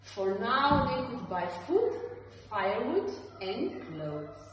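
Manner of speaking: usual speaking voice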